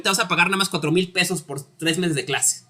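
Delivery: ordinary talk, nothing marked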